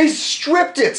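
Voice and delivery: high slow stressed stripped; indignant emphasis. Real, not acted. Indignant argument.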